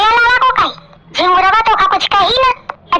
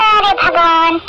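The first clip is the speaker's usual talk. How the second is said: ordinary delivery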